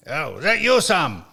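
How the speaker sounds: mock Scottish caller; imitating a Scotsman on the phone; Scottish accent. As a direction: put on a light Scottish accent. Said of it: "he's Scottish".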